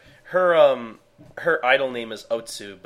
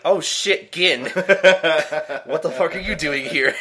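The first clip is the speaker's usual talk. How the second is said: higher, louder, laughing surprise; surprised shout; 6 semitones higher, 8 dB louder than usual. Retold or imitated. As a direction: caught off guard, blurt it out. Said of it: "And, and he's like".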